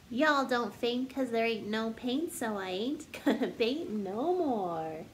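bouncy storybook narration building up the list; story reading. Acted. Teacher reading a silly picture book to kids, bouncy storytelling. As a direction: read it like a picture book to children. Sings-songy refrain, teasing guesses.

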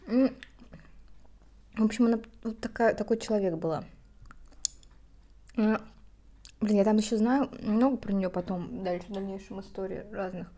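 talking between bites; chewing while talking. Real, not acted. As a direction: mouth partly full. Seen 1: Eats seaweed at -2; cheeks work, mouth closed while talking.

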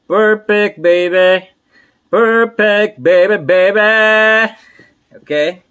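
high, loud barker's cry; drawn-out, showy shout; carnival barker. Pretend. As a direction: belt it out like a fairground caller. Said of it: "Pasensya na kayo sa aking boses".

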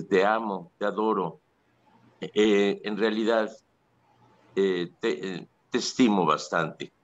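usual speaking voice

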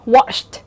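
watched here sounded as wash plus t. Shown as a demonstration. Teacher demonstrates the ED rule, ending on t. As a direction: finish watched with a voiceless t. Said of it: "E D đó sẽ được phát âm là âm".

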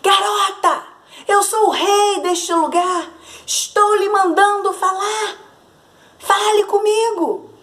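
put-on the tyrant king barks orders at the girl; rude shouting. A narrator's reading. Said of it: "começou a gritar mal educado". (grito mandão e mal-educado do rei)

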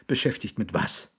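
plain reading voice

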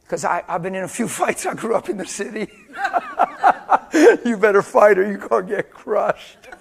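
high, loud laugh through the words; real laughter breaking in. Real, not acted. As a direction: crack up while talking. Joking aside about growing up fighting.

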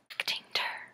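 very soft hushed whisper; whispered aside. Shown as a demonstration. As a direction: whisper the jargon as a quick aside. Whispered aside defining the jargon.

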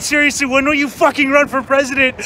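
ordinary delivery

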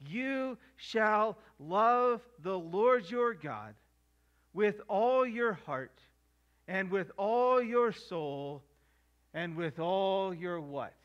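each "all" leaned on, slow and deliberate; solemn scripture reading, "all" stressed each time; slow emphatic reading. Shown as a demonstration. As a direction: land hard on every "all". Preacher reads the great commandment.

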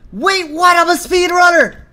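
very high, loud disbelief; real high-pitched gamer excitement; amazed, elated yell. Real, not acted. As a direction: shout it in thrilled astonishment. Amazed by own quick progress.

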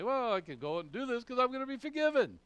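high, loud mock-blithe excuse; mock carefree self-excusing. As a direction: imitate someone breezily excusing themselves. Mimics a presumptuous believer.

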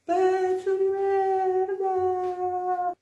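soft, high, drawn-out insult; too quiet to hear. Acted. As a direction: stretch each syllable out faintly. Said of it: "Non ti sento!". (insulto flebile, acuto e lentissimo)

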